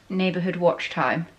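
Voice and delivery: ordinary delivery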